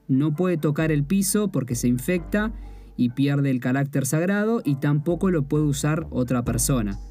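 ordinary talk

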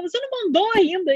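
high, fast incredulous mum; imitated irritated mother. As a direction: act out mum's exasperated disbelief. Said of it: "minha mãe começa a ficar irritada".

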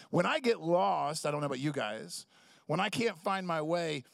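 ordinary delivery

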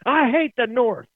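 high, loud Southern grievance; hammed-up regional outburst; mock angry Southerner. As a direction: holler it like a worked-up Southerner. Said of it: "Everyone's down south like".

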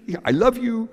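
imitated child's high-pitched declaration; little girl to doll. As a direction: tell your doll in a high toddler voice. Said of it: "sagte es auch ihren kleinen Püppchen".

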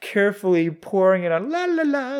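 high, airy la-la mockery; mocking singsong. Mocking her carefully pouring acid, singsong. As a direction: lilt upward in mock carefree fashion.

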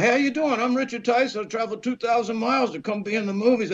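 high-pitched, hurried self-introduction; eager sales pitch. Retold or imitated. Young actor introducing himself to a studio man, eager and hurried.

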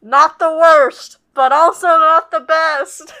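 loud, slow, high teasing verdict; playful verdict. Pretend. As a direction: drawl it out as a mock judge. Joking verdict on ring.

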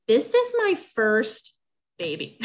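a stunned, pleading protest; exasperated plea. Retold or imitated. Exasperated plea to hospital staff.

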